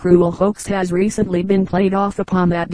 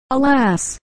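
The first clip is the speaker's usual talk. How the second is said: a high, pitying cry of alas; lamenting cry; 6 semitones higher than usual. A narrator's reading. A friend at the gallows crying out in pity. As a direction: throw the word up in mock grief. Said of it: "cried one as round in air he spun".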